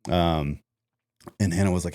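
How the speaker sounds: ordinary delivery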